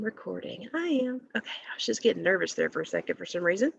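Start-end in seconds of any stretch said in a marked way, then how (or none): none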